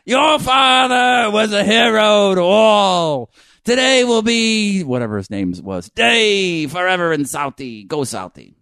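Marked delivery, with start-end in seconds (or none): 0.0-4.8 high, loud, drawn-out stage cry; overacted Boston accent. LA actor honoring murdered father, bellowed Boston melodrama. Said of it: "actor types trying to do a Boston accent". 5.9-8.5 overacted Boston accent. LA actor closing a tribute, hammy Boston accent. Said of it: "actor types trying to do a Boston accent".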